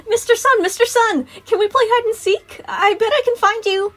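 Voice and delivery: put-on very high, loud, eager little girl; high-pitched child's shout, playful; child calling out. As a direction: call across the playground like an excited kid. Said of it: "Mary called".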